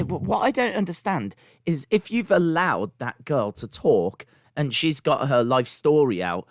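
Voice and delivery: normal talking voice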